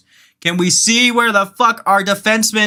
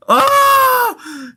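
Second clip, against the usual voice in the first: high sharp yelp of excitement; excited shout; 3 dB louder than usual. Real, not acted. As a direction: burst out with a high Oh. Shout at a missed chance.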